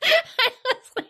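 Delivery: laughing. Real, not acted. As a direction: overcome with giggles. Laughing at dumb scene.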